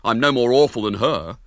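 a hint of a cross boy standing up for himself; indignant boy. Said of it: "said William indignantly".

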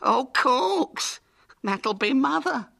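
high, tearful wail; dismayed. A narrator's reading. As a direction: wail it in horror. Hubert in dismay.

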